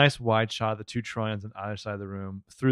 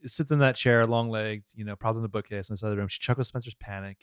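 ordinary talk, nothing marked

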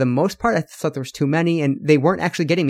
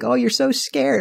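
teasing; 9 semitones higher than usual. Retold or imitated. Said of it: "That's why they're like".

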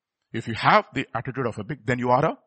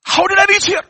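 high, loud cry of bewilderment; acted prodigal son's outcry; dismayed shout; 10 dB louder than usual. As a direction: yell it like someone coming to his senses. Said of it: "And he says".

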